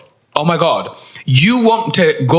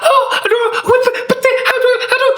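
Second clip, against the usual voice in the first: much higher flustered stammer; hammed-up panicked young barista, laughing; mocking a flustered barista; overwhelmed Gen Z barista. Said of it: "overwhelmed little Gen Z that's like".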